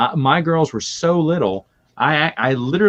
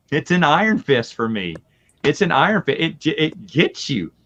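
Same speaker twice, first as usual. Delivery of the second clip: normal talking voice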